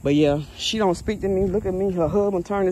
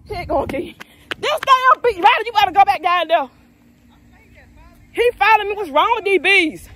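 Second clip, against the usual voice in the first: panicked shout; 11 semitones higher than usual. Real, not acted. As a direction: shriek as the bees swarm back. Bees come back at her.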